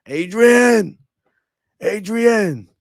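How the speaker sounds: Stallone's Adrian scream; Rocky yelling Adrian. Retold or imitated. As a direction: bellow the name across the ring. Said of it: "Sly Stallone".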